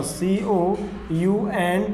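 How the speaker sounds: softly drawn-out letters; slow spelling. Shown as a demonstration. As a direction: spell very slowly, one letter at a time. Teacher spells word while writing.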